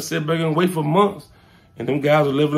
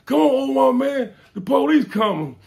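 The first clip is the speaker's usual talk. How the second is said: retold yell; 9 semitones higher than usual. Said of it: "somebody yelled".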